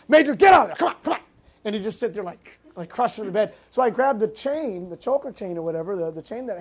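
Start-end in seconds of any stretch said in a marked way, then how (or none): 0.1-1.2 louder, higher retold yell; yelling at the dog. Ordering the dog out from under his bed, retold loud yell. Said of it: "yelling at Nellie".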